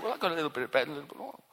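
poor widow hurriedly admitting her last food; widow's rushed reply. Retold or imitated. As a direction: blurt it all out in a hurry. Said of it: "she says".

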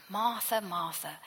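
a tender Martha, Martha, dropping soft on the second; affectionate and tender. Acted. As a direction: say her name twice, gently fading. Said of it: "he's being affectionate and tender".

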